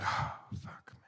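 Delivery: whispered, breath-only curse; imitating a stunned friend's hushed swearing; retold shaken mutter. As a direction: sigh it out under your breath. Shaken friend acted on sitting down.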